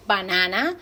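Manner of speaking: "banana" swept up at the end; rising intonation. Shown as a demonstration. Teacher pointing out a list item, rising on "banana". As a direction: lift the last syllable of "banana". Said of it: "sube la entonación".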